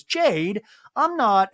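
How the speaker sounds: high, loud protest. Retold or imitated. Ashlyn protesting.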